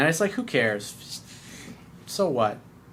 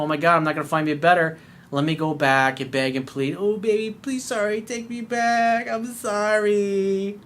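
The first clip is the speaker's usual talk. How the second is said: whiny begging; 5 semitones higher than usual. Retold or imitated. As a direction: beg like a pathetic ex-boyfriend, dragging it out. Mocking a begging ex.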